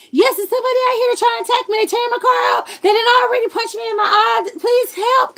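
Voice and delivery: high, loud, rushed plea for help; frantic caller begging police fast; panicked 911 call. Retold or imitated. Woman calling police in panic, high and frantic.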